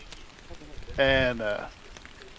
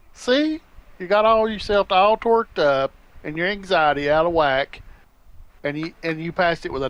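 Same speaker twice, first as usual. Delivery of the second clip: higher and louder, teasing I-told-you-so; excited told-you-so; 5 semitones higher, 6 dB louder than usual. Retold or imitated. Father retelling how he teased his stressed daughter, excited told-you-so. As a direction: rib her, higher and louder.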